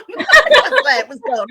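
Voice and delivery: high, loud laughter through the words; laughing. Real, not acted. Guest laughing about sending her chapter, loud. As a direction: laugh it out in disbelief.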